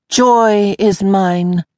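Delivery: very slow, flat, joyless; flat and miserable. Acted. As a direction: drag it out, utterly miserable. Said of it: "the flat, miserable voice".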